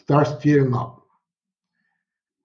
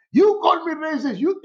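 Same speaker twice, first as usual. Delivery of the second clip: mock indignant accusation; 10 semitones higher than usual. Colleague accuses him in restaurant.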